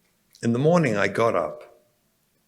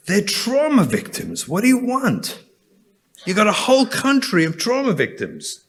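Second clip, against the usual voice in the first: impassioned plea; 9 semitones higher than usual. Retold or imitated. Pleading case to the stewardess.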